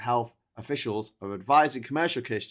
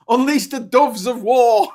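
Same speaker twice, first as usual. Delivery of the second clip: high, loud hammy war cry; mock battle cry; 11 semitones higher, 12 dB louder than usual. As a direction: shout like a general rallying troops.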